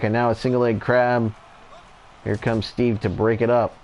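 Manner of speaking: steady wrestling commentator call; live play-by-play. Acted. Commentator calling the tag match for viewers, play-by-play. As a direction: call each move as it lands.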